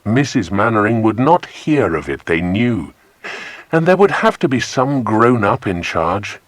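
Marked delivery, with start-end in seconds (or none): none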